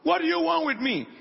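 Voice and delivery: imitation of a shouted demand; mock shout. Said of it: "and shout".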